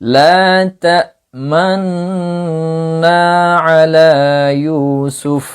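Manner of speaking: cantillated Quranic recitation with ishmam. Shown as a demonstration. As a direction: recite it slowly as in tilawah, lips rounding on تأمنا. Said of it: "পেশের দিকে ইশারা করবেন".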